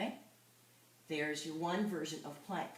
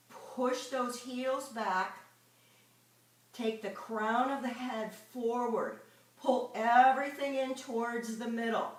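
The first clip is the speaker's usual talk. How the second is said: louder, driving fitness coach cues; intense workout cues; 4 semitones higher, 4 dB louder than usual. Acted. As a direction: push the class through the plank hold.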